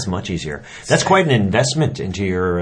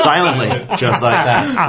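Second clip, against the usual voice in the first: laughing; 4 semitones higher, 4 dB louder, slower than usual. Real, not acted. Laughing at airmail joke.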